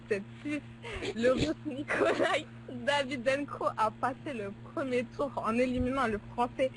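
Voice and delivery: usual speaking voice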